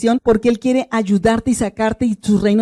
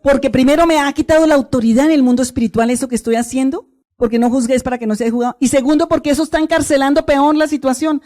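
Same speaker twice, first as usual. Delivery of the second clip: fervent prayer of repentance; 2 semitones higher than usual. Acted. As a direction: pray it out with weight and force.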